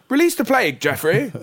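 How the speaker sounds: high, loud insistent call; repeated God command. Retold or imitated. God repeats himself to baffled Jeffrey, insistent. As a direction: press the order on him.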